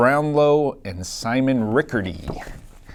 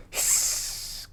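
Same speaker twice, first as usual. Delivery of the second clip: hiss; 10 dB quieter than usual. A narrator's reading. Angry snake in book.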